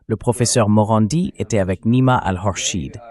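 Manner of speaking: ordinary delivery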